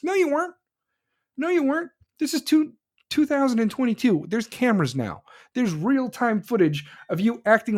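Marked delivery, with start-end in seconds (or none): 0.0-0.5 high, scoffing no you weren't; scornful retort. Acted. To Newsom, mocking his excuse: a high scoffing denial. 1.4-4.2 the same high scoff again, a touch flatter; repeated scornful retort. Acted. To Newsom, repeating the denial with a high scoff.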